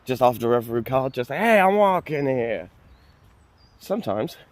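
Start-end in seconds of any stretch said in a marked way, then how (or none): 1.3-2.7 drawn-out, higher New York accent; New Yorker impression. Shown as a demonstration. Showing viewers a card-game voice, mock New York accent. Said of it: "You could do it as a New Yorker".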